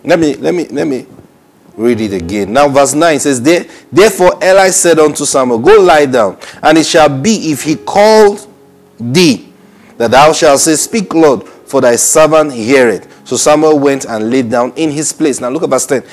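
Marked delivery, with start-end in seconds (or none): 3.4-15.1 loud, raised preacher's reading voice; emphatic scripture reading. Acted. Preacher reads Eli's instruction to Samuel, emphatic scripture reading. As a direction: proclaim the verse to the congregation.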